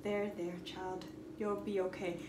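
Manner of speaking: dad soothing. Retold or imitated. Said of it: "he had to just be like".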